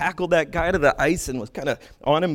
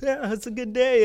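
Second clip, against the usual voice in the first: laid-back goalie; 8 semitones higher than usual. Retold or imitated. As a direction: say it like nothing fazes you. Imitating the chill goalie.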